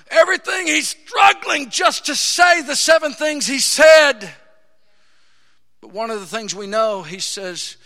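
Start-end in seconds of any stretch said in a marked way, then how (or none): 0.1-4.3 loud, rising preacher's cry; fiery preaching. Real, not acted. Preacher building to a shout before the church. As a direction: preach it out full force to the congregation.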